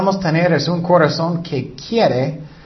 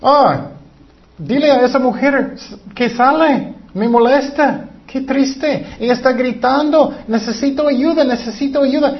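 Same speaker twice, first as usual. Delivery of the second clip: imitando a discípulos quejosos, más agudo; queja fastidiada; 8 semitones higher than usual. Retold or imitated. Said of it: "Ellos estaban quejando". (queja burlona de los discípulos, voz más aguda)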